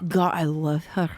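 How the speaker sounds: a lightly hammed-up smitten ogre. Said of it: "let me do that again".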